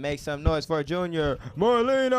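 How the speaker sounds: big rising intro, name stretched out loud and high; stage announcer. Real, not acted. MC bringing the next comedian to the stage, drawn-out announcer shout. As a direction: hype up the crowd, dragging out the last name.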